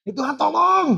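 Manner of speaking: loud, high-pitched cry for help; hammed-up desperate shout; desperate call. Shown as a demonstration. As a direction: yell to God as if in urgent danger. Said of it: "kalau manggil Tuhannya bukan".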